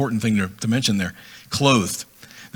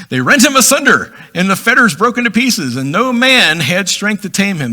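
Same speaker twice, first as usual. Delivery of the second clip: dramatic scripture reading; 7 semitones higher, 5 dB louder than usual. Acted. Reads scripture aloud.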